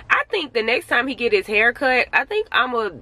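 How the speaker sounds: ordinary talk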